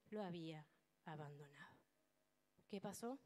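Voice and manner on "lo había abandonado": sorrowful fade. Acted. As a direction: lower and softer, sinking away. Lectura del abandono de Dios. (susurro triste que se apaga)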